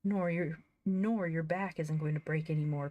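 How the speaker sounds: ordinary delivery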